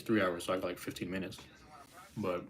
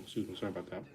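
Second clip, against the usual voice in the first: mouth full; 4 dB quieter, faster than usual. Real, not acted. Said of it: "excuse me, 'cause I'm chewing".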